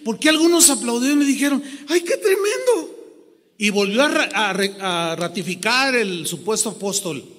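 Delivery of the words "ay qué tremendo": high squealed amazement; mock amazement. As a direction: imitar a alguien impresionado, chillando agudo. Quotes applauding listeners. (asombro fingido con voz muy aguda)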